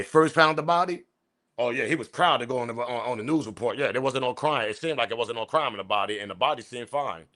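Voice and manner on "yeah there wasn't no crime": normal talking voice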